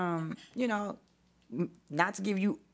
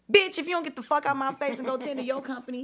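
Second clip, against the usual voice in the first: pitched-up, louder telling-off, half acted; snapping back at a pushy ex, raised and sharp; retold cuss-out; 6 semitones higher, 3 dB louder than usual. Cussing out the ex at a party.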